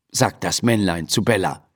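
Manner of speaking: usual speaking voice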